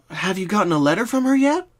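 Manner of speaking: Twilight voice. Acted. Twilight questions Pinky to find the dreams' cause. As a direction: ask like a focused young scholar.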